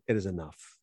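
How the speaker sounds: low, soft, resigned-sounding answer; hushed, low dismissal; subdued reply. Acted. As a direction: drop right down, quiet and final. Jesus answers the eager disciples.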